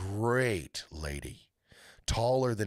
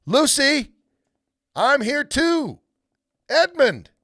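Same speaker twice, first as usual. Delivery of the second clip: high, loud call across the woods; shouting; 12 dB louder, slower than usual. Acted. Boy lost in the wood shouting for Lucy. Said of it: "and so he shouted".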